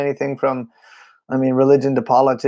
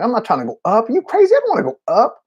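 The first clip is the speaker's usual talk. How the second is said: much higher panicked refusal; panicked protest; 9 semitones higher, faster than usual. Retold or imitated. Drummer refusing the worship leader's look in high panic. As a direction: squeal it out in alarm. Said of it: "and I'm like".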